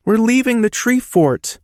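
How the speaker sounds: put-on high, young boy's voice; child's voice. A narrator's reading. Little boy protesting to his dad about leaving.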